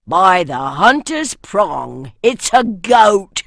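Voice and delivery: loud, slow surprised oath; startled exclamation. A narrator's reading. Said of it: "the first voice exclaimed".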